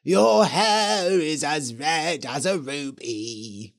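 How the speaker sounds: slow, eerie witch voice; a hint of a spooky ghost witch. A narrator's reading. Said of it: "said the Red Witch".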